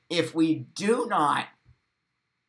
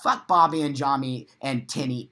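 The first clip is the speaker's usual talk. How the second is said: usual speaking voice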